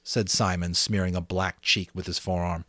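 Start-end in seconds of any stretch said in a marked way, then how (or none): none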